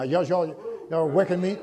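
ordinary delivery

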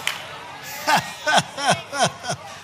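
high staccato laugh; preacher's laugh. Real, not acted. Preacher delighted by his own point, laughing high. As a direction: burst into high, pulsing laughter.

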